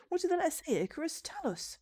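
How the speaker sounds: high-pitched pleading villager voice; curious villagers. Acted. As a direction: press the hero in a high voice. Villagers press Icarus for news.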